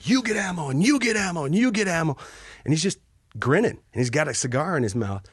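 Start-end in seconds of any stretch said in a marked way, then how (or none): 0.0-2.2 much higher gleeful game-show host voice; high, excited mock host announcing prizes; Oprah Winfrey giveaway. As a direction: hand out ammo like a TV giveaway. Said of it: "Oprah Winfrey giveaway".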